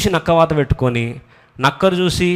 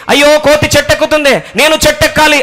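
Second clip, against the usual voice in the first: higher, louder mock wail of a jealous fish; envious fish; 13 semitones higher, 6 dB louder than usual. Preacher voicing the fish in a parable, loud mock wail. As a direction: act out the fish's envious cry. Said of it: "ఇప్పుడు చేప బయటకొచ్చి".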